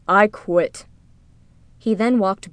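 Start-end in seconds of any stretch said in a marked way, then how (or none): 0.1-0.9 lightly voiced grumbling guard; mild grumble. A narrator's reading. Disguised guard to the taxman who scolded him, mild grumble. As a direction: grumble it in a slightly put-on character voice. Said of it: "Merrick grumbled as he climbed down".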